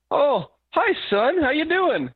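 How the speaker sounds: high, sweet mock mother voice on the phone; imitating mom. Son voicing his mother on Skype.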